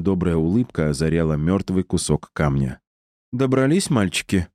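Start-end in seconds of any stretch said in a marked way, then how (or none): none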